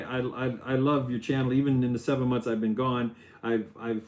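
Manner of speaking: usual speaking voice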